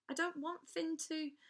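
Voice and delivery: put-on girl blurting in panic before being cut off; panicked blurt. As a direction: blurt it in panic and stop short.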